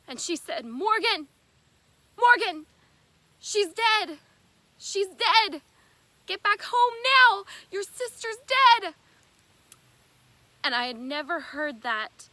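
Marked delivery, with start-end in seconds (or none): 0.7-8.9 frantic retold scream, much higher and louder; hysterical screaming. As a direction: shriek it like a mother in panic. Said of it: "she was screaming hysterically".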